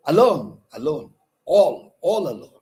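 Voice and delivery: "Alone, alone, all" in separate high, punched beats; rhythmic metre. Shown as a demonstration. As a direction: hit LONE in "Alone" loudly and keep the beat. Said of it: "give the impression of".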